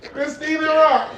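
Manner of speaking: loud, high call, slow on the name. Real, not acted. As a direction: call her name across the room. Calling her name loudly.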